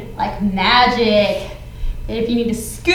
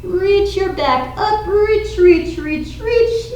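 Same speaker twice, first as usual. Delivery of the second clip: rising reach cue; 6 semitones higher, slower than usual. Shown as a demonstration. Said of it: "Back to your cat".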